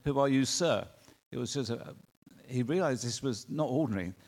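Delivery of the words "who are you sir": louder challenge to a stranger; direct, puzzled question. Acted. Paul questions an unknown voice, direct and louder. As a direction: ask firmly who this is, voice falling on sir. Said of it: "He was effectively saying".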